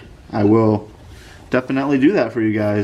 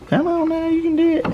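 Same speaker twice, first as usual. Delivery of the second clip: high-pitched encouraging coax. Real, not acted. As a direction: coax it like cheering on a pet. Coaxing stuck part off.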